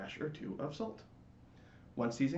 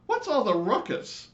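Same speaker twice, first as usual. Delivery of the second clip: high, loud, indignant chorus voice; fussy neighborhood lights; annoyed lights; 13 semitones higher, 10 dB louder than usual. Acted. The lights scolding the screaming latke, high and indignant. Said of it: "said the lights in unison".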